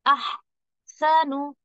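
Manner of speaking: अहसनु very slow with audible breath; breathy drawn-out recitation. Shown as a demonstration. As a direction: let the breath flow through अहसनु. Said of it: "देखिए क्या किया मैंने". (अहसनु को सांस के साथ धीरे से)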